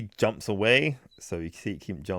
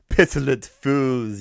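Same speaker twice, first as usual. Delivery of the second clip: slow, loud, higher villain sneer; gloating villain; 6 semitones higher, 7 dB louder, slower than usual. Retold or imitated. Red Skull gloating at the heroes, a slow loud sneer. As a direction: sneer it out slowly like a movie villain.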